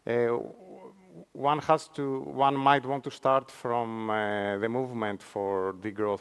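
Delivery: ordinary talk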